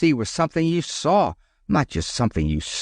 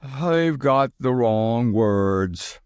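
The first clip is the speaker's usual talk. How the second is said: drowsy; much slower than usual. A narrator's reading. Sleepy Teddy, very slow.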